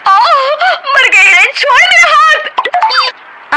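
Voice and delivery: high, loud wail of pain, drawn out; sister-in-law with her arm twisted, wailing; pained cry. Acted.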